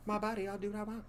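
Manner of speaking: high put-on bratty teen voice; mock defiant teen. Teasing wife as a mock defiant teen.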